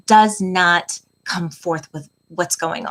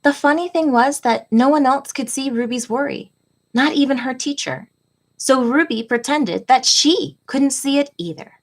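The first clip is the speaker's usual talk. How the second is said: gentle, expressive picture-book read-aloud, often higher; reading to children; 4 semitones higher than usual. A narrator's reading. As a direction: read it like storytime for little kids. Reads picture book to class.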